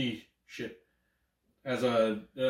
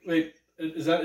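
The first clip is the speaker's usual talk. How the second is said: normal talking voice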